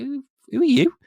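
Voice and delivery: high squeaky indignation; indignant squeal. Retold or imitated. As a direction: squeal it out, offended. Mock indignant driver.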